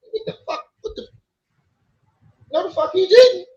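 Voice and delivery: high, loud disbelieving yelp; stunned disbelief. Retold or imitated. Narrator recalling the judge dismissing his case, high loud disbelief. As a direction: shriek it like you can't believe it.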